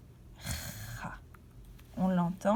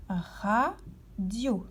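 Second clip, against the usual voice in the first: "radio" drawn out, slow and even, syllable by syllable. Shown as a demonstration. Teacher to child listening for syllables, slow and drawn out. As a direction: stretch "radio" so a child hears each syllable. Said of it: "À quel endroit entends-tu la syllabe ra".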